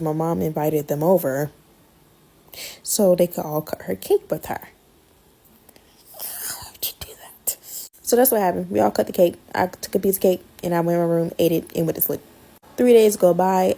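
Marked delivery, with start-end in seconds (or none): 6.1-7.6 slow unvoiced whisper; a slow, exasperated whisper; whispered aside. Real, not acted. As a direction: breathe it out slowly as a secret aside. Aside about unwanted guest.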